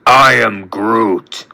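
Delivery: low, slow creature voice; Groot. A narrator's reading. Tree creature answering the despairing friend, low and slow. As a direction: draw the words out deep and slow.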